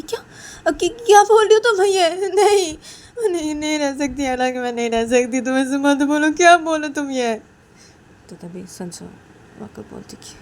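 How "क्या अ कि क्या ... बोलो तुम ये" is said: high, loud tearful pleading; sobbing, desperate protest; crying plea. Acted. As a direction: beg through tears not to be parted. Uma pleads, crying, not to separate.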